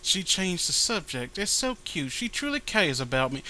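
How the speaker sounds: lightly voiced girl character; comic character voice. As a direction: read her line like a fond girl. Reads comic character lines.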